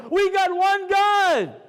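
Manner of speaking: high, drawn-out shout to the whole room; ringing declaration of faith, GOD held long; loud proclamation. Shown as a demonstration. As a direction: proclaim it slowly at full voice. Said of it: "Let me do a little Kiddush Hashem in this room".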